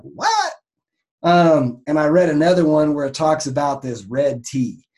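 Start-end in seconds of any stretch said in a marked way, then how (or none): 0.0-0.5 squeaked high what; incredulous. Retold or imitated. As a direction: shoot the word up in disbelief. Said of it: "you're like".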